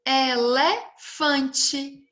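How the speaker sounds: Elefante slowly stretched as e-le-fan-te; syllable by syllable. Shown as a demonstration. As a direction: draw out each syllable of Elefante for pupils. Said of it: "Eu tenho quatro sílabas".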